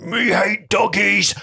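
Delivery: slow, high dopey giant voice; dopey cartoon bellow. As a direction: play a dim giant whining. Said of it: "The giant says something like".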